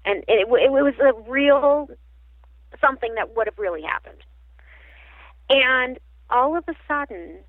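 usual speaking voice

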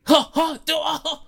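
high, loud burst of laughter; high-pitched ha-ha; bashful laughter. Acted. Laughing off the teacher idea.

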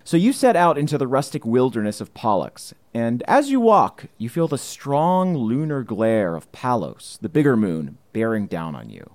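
storyteller narrating the moonlit wilderness; scene narration. Acted. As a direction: set the scene like a game master. GM sets the scene.

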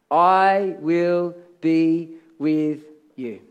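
very slow, measured, word by word; solemn divine promise. Acted. God answers Moses after "Who am I?", solemn and very slow.

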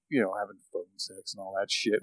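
near whisper. Real, not acted. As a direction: voice dropped low and hushed. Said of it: "Why am I whispering?".

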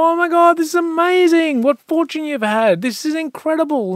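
high, loud, amazed gushing; gushing neighbours. Retold or imitated. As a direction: gush like a thrilled neighbour. Neighbours gush over good fortune.

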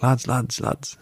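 imitated German-accented call to teammates. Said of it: "German accent".

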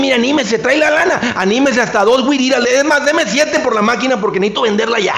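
high-pitched, escalating begging pitch; desperate seller. Acted. Seller dropping the price to a buyer, desperate. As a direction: plead for a sale right now. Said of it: "Se le vuelve lo desesperado".

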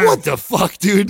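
high shouted disbelief; retold outburst. Storyteller reenacts reaction to girl, shouted disbelief. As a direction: shout it like a baffled protest.

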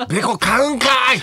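high, loud Kansai-style retort; Kansai-dialect tsukkomi. Retold or imitated. Comedian mimicking his own Kansai retorts, laughing shout. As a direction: shout it as a hammed-up Kansai comeback. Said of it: "関西弁で突っ込んでる時ありますよね".

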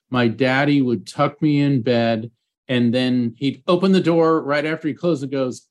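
ordinary delivery

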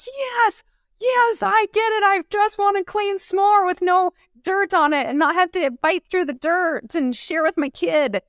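very high, emphatic mock agreement; imitated excited moms. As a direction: gush as the relieved customers, high-pitched.

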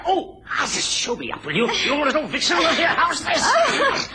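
high, raging snarl at her; furious threat. Acted. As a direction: lash out in a rage.

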